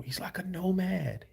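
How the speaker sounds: breathy whispered aside. Real, not acted. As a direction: whisper it to yourself. Repeats remark as aside.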